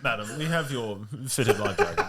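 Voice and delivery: mock-genteel servant voice; courteous announcement, barely holding back laughter; gentle, deferential steward. Steward to rich lady, exaggerated gentleness. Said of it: "the rich people get it all gently".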